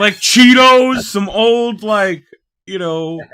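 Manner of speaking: high, loud, slow goofy voice; exaggerated goofy voice. Mocking beard guy answer.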